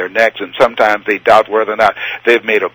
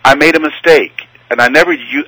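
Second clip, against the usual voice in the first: ordinary talk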